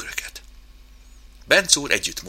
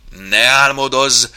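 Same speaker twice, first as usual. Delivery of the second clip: loud, slow, stretched interruption; blaring; 6 semitones higher, 8 dB louder, slower than usual. Acted. Chimp cuts short mouse's dreaming, blaring. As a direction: boom it out to break the daydream. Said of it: "harsogta Oszkár".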